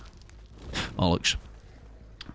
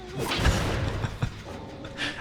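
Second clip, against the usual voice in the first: high breathy wheeze laugh; real wheezing giggle; squeaky giggle; 6 dB quieter than usual. Real, not acted. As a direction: giggle helplessly.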